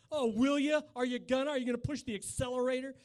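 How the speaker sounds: teasing thrill; mock excitement. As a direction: egg the driver on sarcastically. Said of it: "and I'm like".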